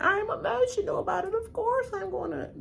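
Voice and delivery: high, whiny mock voice; mock emotional Carolyn. Carolyn defending herself at roundtable, high whiny mock. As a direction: whine it like she's all feelings.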